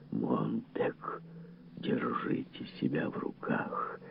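warning whisper, slow and hushed; slow, voiceless urging; Faber. A narrator's reading. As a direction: whisper a stern caution into an ear. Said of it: "предостерегающий шёпот Фабера".

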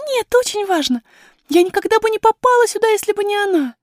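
put-on high girlish voice, insisting; piping, emphatic little princess; insistent young princess. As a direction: protest earnestly in a high child's voice. Princess's figure in the story.

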